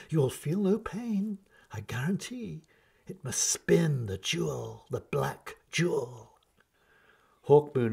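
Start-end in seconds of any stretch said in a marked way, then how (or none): 0.1-6.3 coaxing whisper. A narrator's reading. Baron coaxes duke into machine.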